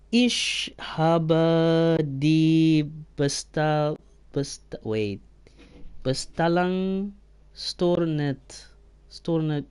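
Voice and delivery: slow, halting sounding-out of foreign words; struggling to read German. Real, not acted. Streamer trying a German message aloud, slow and halting. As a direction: stumble through unfamiliar German. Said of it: "I can't even say any of these words".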